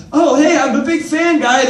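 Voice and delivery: clueless fan shout. Retold or imitated. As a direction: blurt it out like a drunk pretending to be a fan. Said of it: "And he's like".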